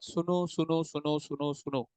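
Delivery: soft, fading repeated call; hushed call for attention. Real, not acted. Teacher asking all the students to listen, softly coaxing attention.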